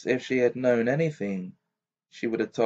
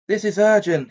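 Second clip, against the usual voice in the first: higher, louder, urgent and fierce; 9 semitones higher, 8 dB louder than usual. A narrator's reading. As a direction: press hard, no time to lose. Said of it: "he said fiercely".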